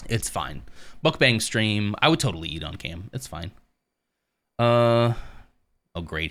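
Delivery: normal talking voice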